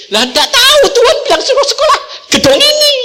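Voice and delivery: high, loud, laughing reply; laughing girl. Retold or imitated. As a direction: giggle it as the carefree daughter. Daughter's reply voiced.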